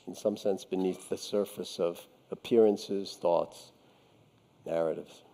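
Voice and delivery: low, soft, contemplative voice with long pauses; meditative teaching. Real, not acted. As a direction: let the words sink down quietly into stillness. Meditative teaching, sinking into stillness.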